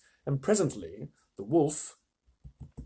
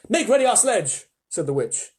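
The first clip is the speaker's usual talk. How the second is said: queen's command; 6 dB louder, slower than usual. Acted. Witch orders the dwarf.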